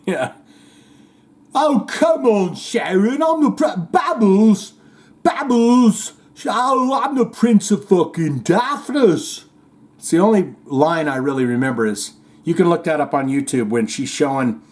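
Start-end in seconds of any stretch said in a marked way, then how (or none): 1.5-9.4 much higher, slow, slurred rocker whine. Retold or imitated. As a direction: mumble and wail like a dazed rock star. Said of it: "your [name] impression".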